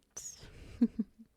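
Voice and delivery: high, breathy little laugh; teasing giggle. Acted. Spider-woman teasing about pheromones, breathy giggle. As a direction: giggle as if caught flirting.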